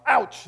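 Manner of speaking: high, sharp yelp; mock pained ouch. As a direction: yelp as if a branch hit you. Acts being hit by branch.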